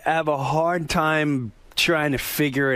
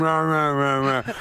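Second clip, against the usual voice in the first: imitating a deaf person. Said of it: "like a deaf person".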